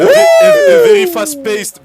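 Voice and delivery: loud excited announcer; hyped English shout. Acted. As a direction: shout it like a hype caster. Caster switches to hyped English.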